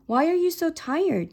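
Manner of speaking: furious. A narrator's reading. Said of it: "furious that she was ignoring him".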